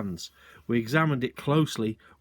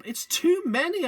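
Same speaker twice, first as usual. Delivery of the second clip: much higher, breathless with wonder; excited cry; 4 dB louder than usual. A narrator's reading. Boy overwhelmed by birds falling everywhere, excited cry. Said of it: "I cried".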